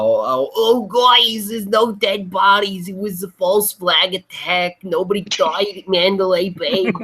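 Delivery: mocking a smug conspiracy poster, a hint of a put-on voice; mocking conspiracy troll. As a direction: ham up a smug truther. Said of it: "reminded from the fucking furry lord".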